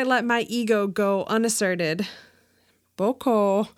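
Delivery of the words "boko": low, grudging grumble; grumbled. A narrator's reading. Annoyed customer answering an unwanted greeting, grumbled. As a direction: give a reluctant little grumble. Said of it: "I grumbled".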